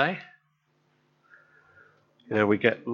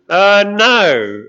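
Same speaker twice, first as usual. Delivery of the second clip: higher, louder sheepish admission; embarrassed reply; 8 semitones higher, 10 dB louder than usual. Retold or imitated. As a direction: own up awkwardly. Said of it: "they embarrassingly reply".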